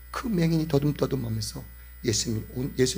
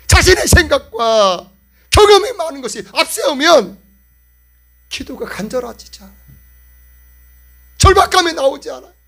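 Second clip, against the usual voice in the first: higher, louder impassioned sermon; full-force preaching; 12 semitones higher, 12 dB louder than usual. Acted. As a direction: preach it with urgent pleading fire.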